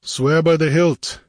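hero's stern order; demanding. A narrator's reading. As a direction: demand the oath firmly. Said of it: "Conan demanded".

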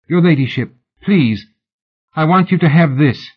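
woman urging a ring on the lady; pleading fan. Acted. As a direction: press the gift on her.

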